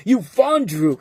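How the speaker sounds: sketch-comedy put-down; SNL-style jab; Chris Parnell impression. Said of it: "I'm like a Chris Parnell on a Saturday Night Live".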